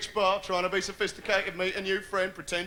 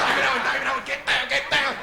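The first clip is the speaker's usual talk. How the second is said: desperate mantra; 4 semitones higher, faster than usual. Acted. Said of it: "trying to think about David Owen".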